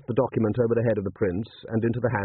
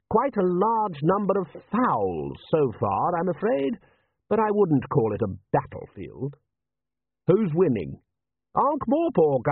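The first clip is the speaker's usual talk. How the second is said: patrician; 8 semitones higher, slower than usual. Acted.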